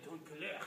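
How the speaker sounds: "tone color" said the French way, like timbre couleur; French pronunciation. Shown as a demonstration. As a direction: give "tone color" a French sound. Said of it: "I love to use this French spelling".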